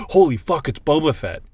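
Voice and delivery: gasp, then a high, loud exclamation; awestruck fan reaction. Retold or imitated. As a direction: act out the internet losing it in amazement. Internet's awed reaction acted out.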